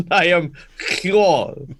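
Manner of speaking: Hjor drawn out slowly with the y sound, yor; slow proud Hjor. Shown as a demonstration. Player proudly claiming the corrected name, slow Hjor. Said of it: "put the yor in Hjorson".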